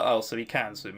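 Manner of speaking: ordinary delivery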